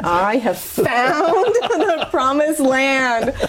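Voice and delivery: high, drawn-out cry of revelation; mock-rapturous proclamation. Wife retelling her reaction to his speech on infidelity, mock-rapturous. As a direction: proclaim it grandly, stretching the words out. Acted reaction, exulting over the man.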